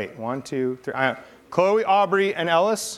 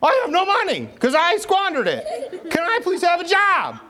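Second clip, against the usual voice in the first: high, silly put-on voice; comic high-voiced begging for work; voicing the son; 13 semitones higher than usual. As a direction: plead in a goofy high-pitched voice. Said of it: "I'm gonna say it for you".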